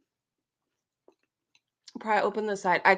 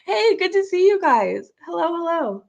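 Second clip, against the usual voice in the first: bright greeting; 8 semitones higher, 4 dB louder than usual. Real, not acted. Greeting chat viewers brightly.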